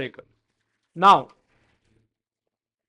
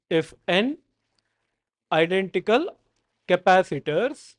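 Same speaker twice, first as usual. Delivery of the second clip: dictating. Shown as a demonstration. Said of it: "Next note".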